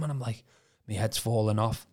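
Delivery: ordinary delivery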